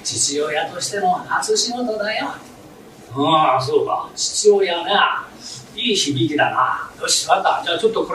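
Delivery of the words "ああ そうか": ordinary talk, nothing marked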